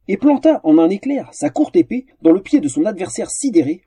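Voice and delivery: plain reading voice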